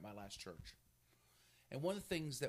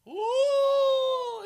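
high long mock wail, much higher and louder; hammed-up falling cry; 19 dB louder than usual. Preacher imitating Peter sinking in the cheesy film. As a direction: ham up a cheesy movie fall. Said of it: "cheesy versions".

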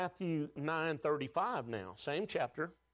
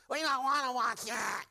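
whiny flesh voice; 8 semitones higher than usual. Retold or imitated. Pastor mocks his flesh refusing scripture, higher whiny voice. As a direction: play your own flesh begging. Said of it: "my old flesh goes".

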